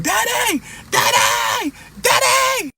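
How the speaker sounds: very high wailing calls of Daddy; crying out. Retold or imitated. Boy screams for his father while mother whips him, very high desperate wails. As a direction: wail for your father like a beaten child.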